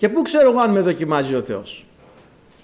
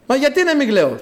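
high-pitched insistent why; acted protesting question; 8 semitones higher, 3 dB louder than usual. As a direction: ask back in dramatised disbelief. Acts mother asking why. (έντονη απορία με διαμαρτυρία)

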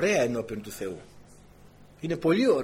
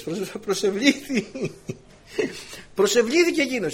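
ordinary talk, nothing marked

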